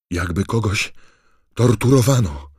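loud, high frightened cry; calling out. Acted. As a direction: blurt it out loudly. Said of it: "zawołał Neville".

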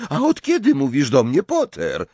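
mildly defiant retort; cheeky retort. A narrator's reading. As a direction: answer back, mirroring the question. Harry answers back.

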